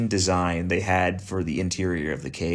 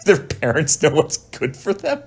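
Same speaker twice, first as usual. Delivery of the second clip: laughing; 7 semitones higher than usual. Real, not acted. Laughing disbelief at friend's remark.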